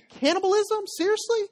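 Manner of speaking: very high-pitched mock disbelief; squeaky-high, scandalized questioning; acted incredulity. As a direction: pitch it way up, as the appalled crowd. Crowd balks at eating Jesus' flesh.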